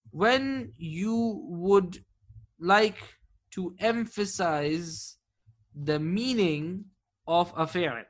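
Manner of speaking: slow, drawn-out words for note-taking; slow dictation. Shown as a demonstration. Teacher dictating the rule slowly to students, slow drawn-out phrasing. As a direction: spell it out slowly so students can write it down. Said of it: "let's write it".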